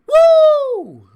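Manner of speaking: very high, very loud screaming woo; Ric Flair woo. Pretend. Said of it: "I don't know how you could do woo in a robotic voice".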